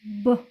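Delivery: ब said short and clipped, a half sound with no vowel; a quick half ब. Shown as a demonstration. Phonics teacher showing the consonant sound, short half ब. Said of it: "आधा ब होता है".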